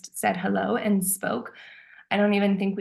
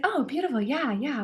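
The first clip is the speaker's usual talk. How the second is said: excited; 6 semitones higher than usual. Retold or imitated. Retold eager reply to friend.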